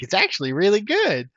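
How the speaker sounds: high-pitched mock friend voice; Chris. Friend talking up a movie, high mock voice. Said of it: "Chris Kavanaugh actually sounded like this".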